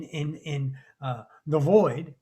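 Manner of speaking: usual speaking voice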